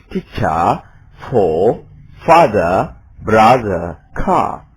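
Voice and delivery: British pronunciation demo; British English. Shown as a demonstration. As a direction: drop every R: teacha, fatha, brotha. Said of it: "R-nya tidak ada".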